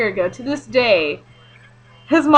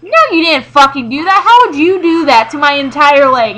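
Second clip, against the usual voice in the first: higher, louder mock exasperation; answering her boyfriend, higher and louder; exasperated; 4 semitones higher, 7 dB louder than usual. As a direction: scoff at the silly question. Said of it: "I'm like".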